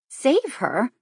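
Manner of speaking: high, rising disbelief; startled question. Acted. Kate echoing the uncle's words in alarm, startled rising question. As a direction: echo his words in shock. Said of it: "he repeated".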